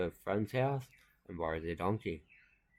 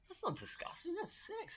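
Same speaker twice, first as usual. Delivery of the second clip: put-on very high, soft girl's voice; girl retorting; 9 dB quieter than usual. Other girl answering her friend, very high. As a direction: squeak it like a know-it-all kid.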